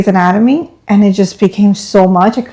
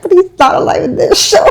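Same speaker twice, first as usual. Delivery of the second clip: laughing protest; 9 semitones higher than usual. Retold or imitated. Protesting to her husband.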